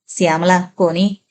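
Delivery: plain reading voice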